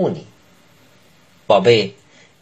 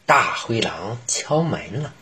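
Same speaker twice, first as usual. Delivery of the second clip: plain reading voice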